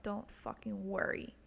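usual speaking voice

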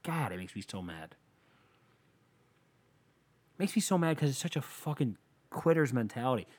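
usual speaking voice

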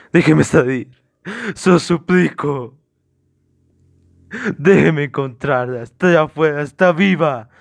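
loud, drawn-out begging, rising in pitch; desperate pleading. Acted. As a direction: beg frantically, stretching the words. Suspect begs to be let out.

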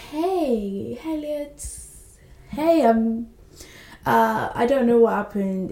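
drawn-out, hesitant hey, lightly acted; awkward greeting. Heroine greeting her ex after ten years, awkward. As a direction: imitate someone caught off guard, stalling with ums. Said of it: "making an awkward wave".

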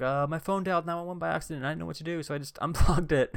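high, nervous retelling voice; sheepish younger self. Retold or imitated. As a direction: squeak it out like a caught kid. Himself answering mom, sheepish.

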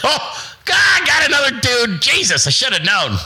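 camp it up, shocked to find another dude; high mock squeal of dismay. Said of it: "You're like".

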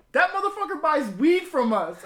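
very high, very loud shout; imitating Chucky's shocked reveal. Friend realizing the teacher's their customer, loud high shout. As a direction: blurt it out in shocked delight.

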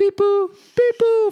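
very high beeping phone noise; imitated phone beep. His phone dying at the counter, high imitated beeping.

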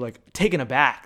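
ordinary talk, nothing marked

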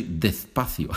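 despacio with TH for both S and C; overdone TH. Shown as a demonstration. Teacher mimics students who overreact, despacio lisped on both consonants. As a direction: say "dethpathio" for despacio. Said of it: "they pronounce it with T, T H".

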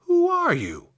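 very high scarecrow question; curious scarecrow. Acted. Scarecrow asks the girl who freed him, curious. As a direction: pitch it far up, inquisitive.

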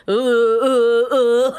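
imitating someone lost for words; awkward drawn-out oohs, mimicked; at a loss. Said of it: "Like, he didn't know what to say".

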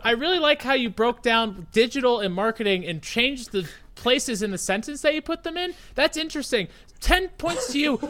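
high, sing-song fake-praising teacher; mocking professor handing out points; mock teacher praise. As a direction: gush like an over-impressed teacher. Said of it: "And she'd be like".

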